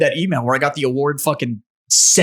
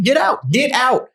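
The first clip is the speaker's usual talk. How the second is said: incredulous; 9 semitones higher, slower than usual. Real, not acted. Disbelief at pay rule.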